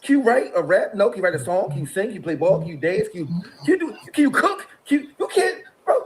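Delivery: ordinary talk, nothing marked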